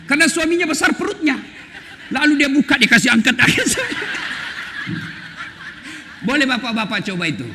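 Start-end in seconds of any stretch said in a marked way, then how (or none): 3.4-5.1 tawa terbahak. Real, not acted. Laughs at own joke.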